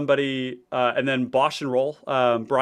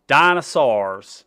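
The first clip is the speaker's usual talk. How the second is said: slow, louder Southern drawl on dinosaurs; southern accent; 8 dB louder, much slower than usual. Pretend. Streamer obliging a chat request, slow loud Southern accent. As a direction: stretch it out like a Southern cartoon DNA guide. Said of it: "Just say dinosaur with a southern accent".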